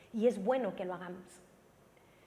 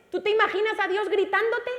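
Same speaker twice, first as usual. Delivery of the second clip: high, loud rhetorical cry; loud preaching; 10 semitones higher, 9 dB louder than usual. Real, not acted. Preacher asking the church to picture God shouting, loud. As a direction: call it out in disbelief. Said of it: "dice que Dios le tuvo que dar voces".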